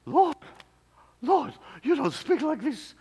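put-on high, loud, shocked South American accent. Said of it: "South American accent".

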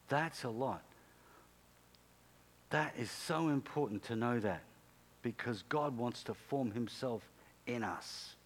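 ordinary delivery

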